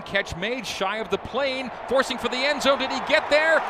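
rising excited live call; play-by-play building up. Acted. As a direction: build the call toward the goal line.

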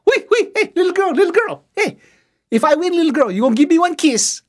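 high, eager flirt, a little laugh; flirting chief.

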